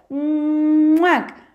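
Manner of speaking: big smacking kiss sound; loud kiss. Acted. Lamb wakes and gives the wolf a big loud kiss. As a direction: plant a noisy smacking kiss.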